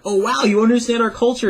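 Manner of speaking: high wowed compliment; impressed Japanese person. Retold or imitated. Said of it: "they'd be like".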